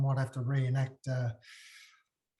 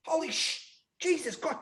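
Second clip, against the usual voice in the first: mock shock; 5 semitones higher, 3 dB quieter than usual. Reenacting the dye going wrong.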